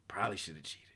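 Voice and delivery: whispered confession. Real, not acted. Confessing to chat he should have cheated, in a whisper. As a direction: breathe it out as a secret whisper.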